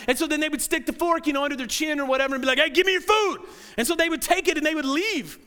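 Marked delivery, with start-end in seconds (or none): 2.6-3.4 raised, higher-pitched mock demand. Preacher voicing Eli's thuggish servants, mock forceful demand. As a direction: act out grabbing food by force.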